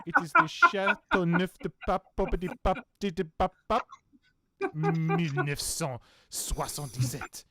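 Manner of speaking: loud, hammy French wine snob; French accent. Pretend. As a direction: proclaim the wine name in mock French. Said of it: "my weird French accents".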